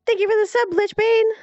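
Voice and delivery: high loud excited shout of thanks. Real, not acted. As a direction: yell out thanks in delight.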